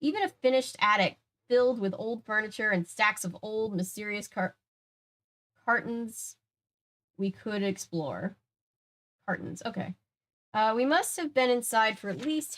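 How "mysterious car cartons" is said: usual speaking voice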